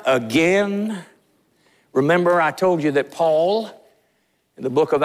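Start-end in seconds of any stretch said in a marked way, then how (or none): none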